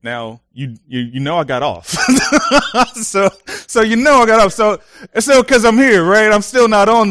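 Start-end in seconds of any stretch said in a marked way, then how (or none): 2.0-2.9 bright burst of laughter; real laugh. Real, not acted. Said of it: "laughs at himself". 3.0-7.1 laughing. Real, not acted. Laughing after fear story.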